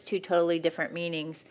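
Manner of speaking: usual speaking voice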